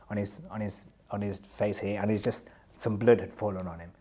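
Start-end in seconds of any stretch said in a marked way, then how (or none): none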